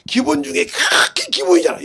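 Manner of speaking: high, loud, tearful protest; indignant cry. Real, not acted. (높고 크게 울분에 차서)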